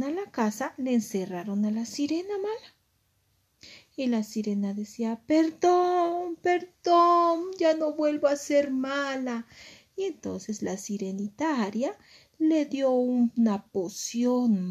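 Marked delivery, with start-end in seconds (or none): 5.3-9.5 high, drawn-out mock pleading; begging forgiveness. Locked-up bad mermaid begs to be let off, pleading. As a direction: wail for mercy, pitched high throughout.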